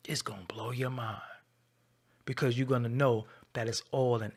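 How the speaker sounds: conspiratorial whisper. Real, not acted. Telling viewers about [name], a secret conspiratorial whisper.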